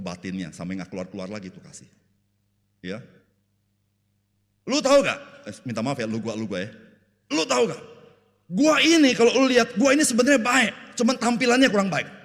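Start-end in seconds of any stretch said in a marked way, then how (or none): 4.7-5.2 high, loud challenge; hammed-up brash yell; mock rough talk. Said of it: "Gila, dia kasar sekali". 7.3-7.8 high, loud challenge; hammed-up brash yell; mock rough talk. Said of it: "Gila, dia kasar sekali". 8.5-12.1 high-pitched shouted self-defence; hammed-up brash yelling; mock rough talk. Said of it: "Gila, dia kasar sekali".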